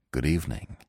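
soft, low, a nervous forced smile in it; trembling forced greeting. A narrator's reading. Gurov to Anna at the theater, soft trembling greeting. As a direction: greet her shakily, forcing a smile. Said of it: "said in a trembling voice, with a forced smile".

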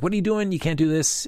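a friend's startled, high-pitched objection; alarmed protest. Retold or imitated. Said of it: "Mac's like".

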